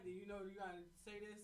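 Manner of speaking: normal talking voice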